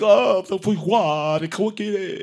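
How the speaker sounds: high, slow fake French; mock French. Stabbed burglar cursing in mock French. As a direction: ham up a French curse. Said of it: "He was like".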